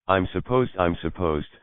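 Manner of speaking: usual speaking voice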